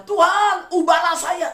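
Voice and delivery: high, loud cry to God; loud pleading prayer. Pretend. Preacher mocking a loud desperate prayer to God, high and loud. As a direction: beg God at the top of the voice to change you.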